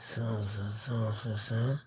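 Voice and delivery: a barely voiced, low murmur of son; very soft. Son muttering softly to himself at the sink. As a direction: breathe the words out softly, almost inaudible. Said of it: "I said as soft as you could imagine".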